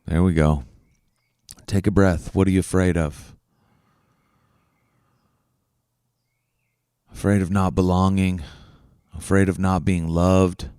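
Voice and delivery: low, soft meditation voice; hushed, low-pitched breathing exercise; guided reflection. Real, not acted. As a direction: guide them gently inward, with long pauses. Leads a breathing and fear reflection.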